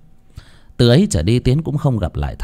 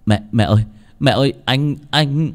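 stammering; 5 semitones higher than usual. A narrator's reading. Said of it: "ấp úng".

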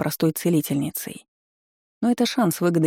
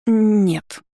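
high, louder squeaked no; 4 semitones higher, 4 dB louder than usual. A narrator's reading. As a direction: squeak it out, holding back a smile. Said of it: "пискнула, пытаясь совладать с улыбкой".

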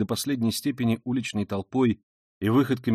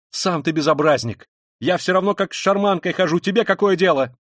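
loud, raised indignant comeback; angry retort; 6 semitones higher, 7 dB louder than usual. A narrator's reading. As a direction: snap back defiantly, louder. Woman argues back at policeman. (громко огрызнуться)

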